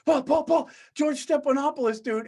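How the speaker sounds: high, loud imitation of a drunk friend yelling; imitating Bill yelling at the Garden; mock calling out. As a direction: call out to your buddy, hammed up.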